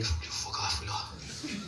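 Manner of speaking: whispered, voiceless telling-off; barely audible, whispered aside; muttered retort. Retold or imitated. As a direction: mutter it under your breath. Retort muttered under his breath.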